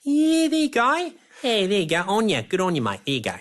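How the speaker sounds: put-on high, cheery Kiwi accent, laughing it up; hammed-up cheery Kiwi accent; New Zealand makeup guys. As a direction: play a breezy New Zealand crew guy. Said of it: "And they're like".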